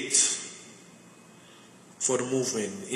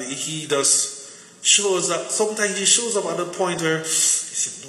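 ordinary talk

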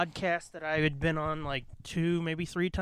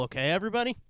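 ordinary delivery

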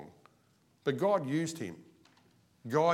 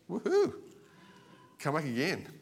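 high whooping cheer; 6 semitones higher than usual. Real, not acted. Pastor cheering the hour-long church, high whoop. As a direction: whoop it up playfully. Cheer about short service.

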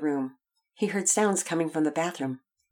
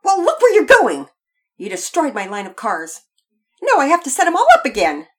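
loud, high-pitched indignant outburst; angry child quarrelling; 10 semitones higher, 13 dB louder than usual. A narrator's reading. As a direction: shout the complaint at a careless brother.

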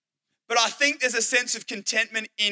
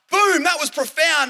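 loud, raised-pitch burst of mock triumph; self-impressed triumph; 6 semitones higher, 5 dB louder than usual. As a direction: proclaim it like a mic-drop moment. Acted triumphant inner reaction.

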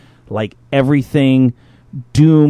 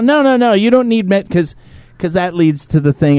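high mock doctor; 7 semitones higher than usual. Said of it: "He's all".

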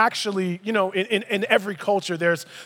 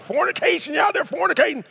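a higher, hammed-up Southern preacher voice; deep Southern accent; 5 semitones higher, faster than usual. Shown as a demonstration. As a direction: put on a Southern accent to poke fun at the old word. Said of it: "you gotta say it with a deep Southern accent".

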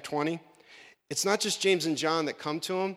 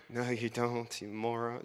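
exasperated put-down; 5 semitones lower, 8 dB quieter than usual. Retold or imitated.

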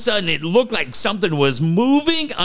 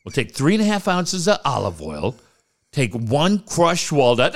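ordinary talk

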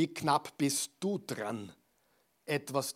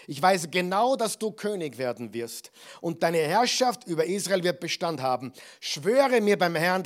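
raised, emphatic dramatic reading; fervent declamation; 2 semitones higher, 4 dB louder than usual. Acted. Saul begs David to spare his descendants, fervent declamation. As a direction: plead with force, leaning on the key words.

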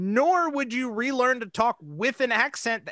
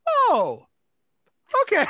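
high, louder "oh" of mock bewilderment; at a loss; 12 semitones higher, 6 dB louder than usual. Retelling the reaction to a wild story, mock dumbfounded. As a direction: react as if lost for words, retelling it. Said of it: "didn't even know what to say".

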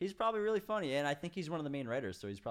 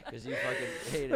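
laughing. Real, not acted. Teasing through laughter.

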